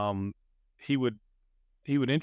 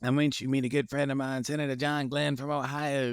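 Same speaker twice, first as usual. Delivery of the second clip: a hint of an old Southern senator's accent; 4 semitones higher than usual. Senator introducing his young assistant to John Glenn, in a light Southern accent. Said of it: "in this Southern accent".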